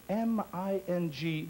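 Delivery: spelled slowly. Shown as a demonstration. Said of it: "spells it out".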